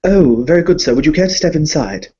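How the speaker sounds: a hint of a timid bank clerk; nervous servant. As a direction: stammer like a flustered doorman. The servant welcomes the guest.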